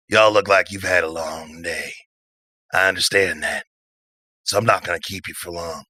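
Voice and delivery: level with them gently; laid-back drifter, frank. Acted. Patch levels with tired travellers, laid-back and frank. Game master voices Patch.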